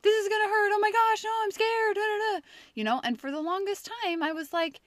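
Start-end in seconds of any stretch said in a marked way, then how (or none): 0.0-2.5 imitating a panicking child, much higher and louder; mock kid dreading the needle, high and loud; scared kid. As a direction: pitch right up and wail it like a frightened little boy. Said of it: "he's like".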